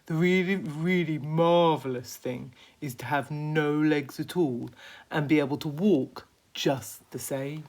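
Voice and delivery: lightly voiced earnest worm; a hint of a prim earthworm. Earthworm character voice.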